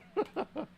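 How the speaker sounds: high, soft giggle; real laugh. Real, not acted. Streamer teasing Tommy, giggling high and softly. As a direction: giggle at your own joke.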